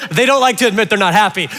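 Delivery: ordinary delivery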